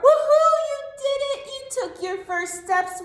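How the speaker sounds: high, loud whoop of joy; cheering. Acted. Teacher cheers the baby's first steps, high and loud. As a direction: cheer like the baby just walked.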